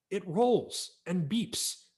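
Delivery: slow, patient spelling-out; slow explaining. Acted. Boy explaining the toy to his brother, slow. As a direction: drag it out like to a dummy.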